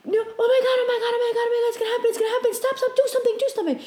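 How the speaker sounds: high, rushed retold panic; freaking out. Dreamer begging her companion to stop a crash, panicked. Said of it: "freaking out thing".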